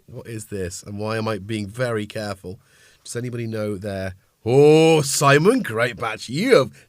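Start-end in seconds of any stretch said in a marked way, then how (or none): 4.4-6.9 much louder, slow, thrilled call-out; delighted shout. Real, not acted. Streamer congratulates viewer on guessing right, loud and delighted.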